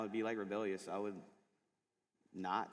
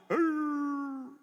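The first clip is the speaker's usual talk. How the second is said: high, loud, drawn-out hound bay; hammed-up baying hound; long drawn-out howl; 9 dB louder than usual. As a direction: stretch the bark into a long howl. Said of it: "long, drawn out".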